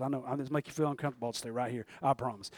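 low, soft, quick aside; reassuring aside. Real, not acted. Preacher promises the uneasy congregation he'll stay right here, reassuring aside. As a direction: drop right down and hurry through it quietly.

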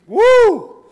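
high, loud whoop; excited whoop. Real, not acted. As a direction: let out a whoop of joy. Preacher shouts in excitement.

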